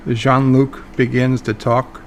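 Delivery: ordinary delivery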